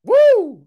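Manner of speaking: loud, high "woo"; sudden high-pitched holler; office whoop. Acted. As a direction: burst out with a wild whoop. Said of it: "go in the office and be like".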